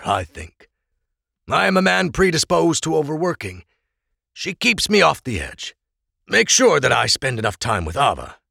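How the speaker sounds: lightly voiced Oral, fond and easy; an old friend teasing back; friendly older man.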